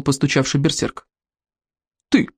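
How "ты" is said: short mock bark; curt berserker command; barking chief. A narrator's reading. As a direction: snap it out as an order, volume held back. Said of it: "рявкнул главный берсерк".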